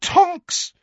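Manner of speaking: very high, loud scolding cry; shrieked reproach; exasperated cry. A narrator's reading. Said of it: "cried Missus Weasley in exasperation".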